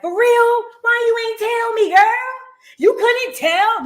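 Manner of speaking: high, loud mock disbelief; incredulous. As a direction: question her in high-pitched disbelief. Acts out own shocked reaction.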